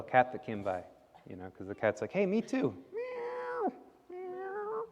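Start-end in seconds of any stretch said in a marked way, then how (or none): 2.1-2.7 high little cat voice. A narrator's reading. The cat asking Sasha for food, in a high cat voice. 2.9-4.8 high mewing meow, fading soft; cat meow. Shown as a demonstration. Teller meowing the cat for listening children. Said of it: "That's a good cat noise".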